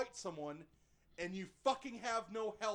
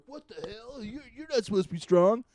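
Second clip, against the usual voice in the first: higher, indignant thug; mock shocked thug; 7 semitones higher, 10 dB louder than usual. Thug cannot believe he lost, higher and mock shocked. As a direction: act stunned like a beaten bully.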